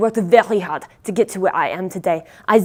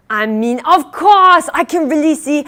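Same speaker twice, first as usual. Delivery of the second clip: overcorrecting enthusiasm; 5 semitones higher, 6 dB louder than usual. Acted. Backpedalling after blunt no.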